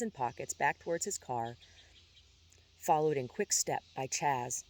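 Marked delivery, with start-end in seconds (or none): none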